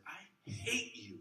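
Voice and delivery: a mock hateful line; meant hatred. Shown as a demonstration. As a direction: spit it out slowly like you mean it. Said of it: "as if I said".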